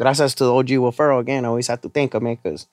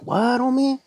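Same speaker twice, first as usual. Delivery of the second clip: surprised; 11 semitones higher, 3 dB quieter, slower than usual. Retold or imitated. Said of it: "And I was like".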